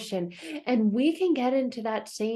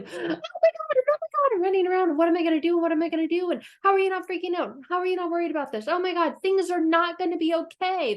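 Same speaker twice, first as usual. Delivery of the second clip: higher, rushed frantic worrying; mock panic; 7 semitones higher, faster than usual. Heartbroken person imitated as Chicken Little, mock frantic panic. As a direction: spiral into a hammed-up freak-out. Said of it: "this panic and this frantic energy of".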